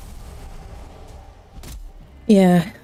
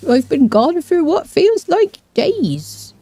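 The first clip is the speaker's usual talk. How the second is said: a lightly voiced lost troll, pitched higher; 7 semitones higher, slower than usual. Streamer voicing her game troll to chat, light troll voice. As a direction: play a bumbling troll, lost and a bit whiny. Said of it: "your troll voice".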